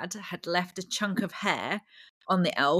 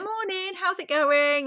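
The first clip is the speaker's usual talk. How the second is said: breezy, higher-pitched mock-casual greeting; really casual; 12 semitones higher, slower than usual. Imitating shaved dad greeting his kids, really casual. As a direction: toss it off as if nothing is amiss. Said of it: "Like, really cash".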